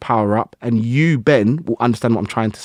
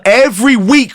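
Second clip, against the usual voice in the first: excited emphasis; 13 semitones higher, 7 dB louder than usual. Real, not acted. Excited about weekly training.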